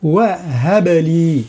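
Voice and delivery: "وهب لي" very slow, the ب of هب given a bouncing release; qalqala on ب. Shown as a demonstration. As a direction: let the ب in "هب" echo. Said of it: "الباء فيها قلقلة". (قلقلة الباء في "هب لي")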